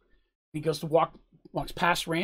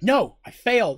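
louder, higher cry of self-blame; upset outburst; 6 semitones higher, 8 dB louder than usual. Acted. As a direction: blurt it out, frustrated. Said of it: "He's like, he's like".

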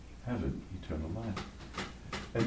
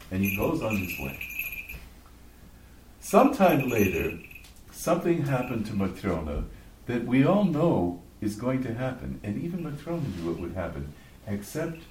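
usual speaking voice

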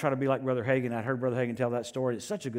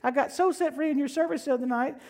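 much higher, lightly put-on lady's voice; sweet woman caller; 12 semitones higher than usual. Pastor mimicking a woman calling to thank him, high put-on voice. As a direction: chirp it like a grateful church lady on the phone. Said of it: "He said, "Hi, [name]. This is Sister So-and-so".

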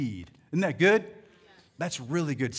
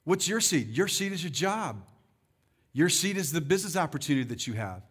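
normal talking voice

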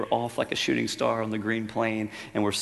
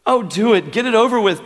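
much higher and louder desperate cry; 13 semitones higher, 14 dB louder than usual. A narrator's reading. Ghost gives the angel permission, crying out. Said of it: "cried the ghost".

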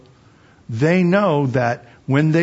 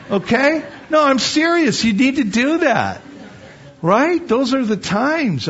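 raised, pressing pulpit voice with hard stresses; fervent preaching; 6 semitones higher than usual. Acted. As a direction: drive the lesson home to the church. Preaching to the congregation.

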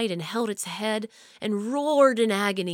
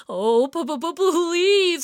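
high, loud, terrified stammer; child caught in a monster's claws; stammering plea; 8 semitones higher, 7 dB louder than usual. A narrator's reading. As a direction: stutter out the plea in panic. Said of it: "cried Sam".

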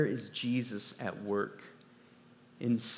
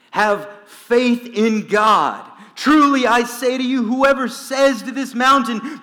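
proclaiming scripture; 7 semitones higher, 10 dB louder than usual. Acted. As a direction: declaim the words of Jesus to the congregation.

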